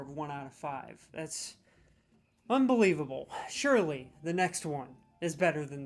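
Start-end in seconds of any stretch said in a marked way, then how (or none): none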